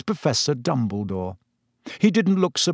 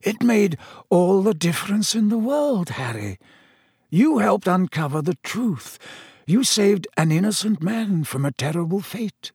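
put-on higher-pitched, earnest old headmaster; Dumbledore, earnest; 8 semitones higher than usual. A narrator's reading. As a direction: reassure him with earnest conviction in a raised register. Dumbledore reassures Harry.

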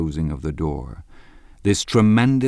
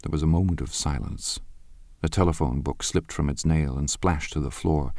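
ordinary delivery, nothing marked

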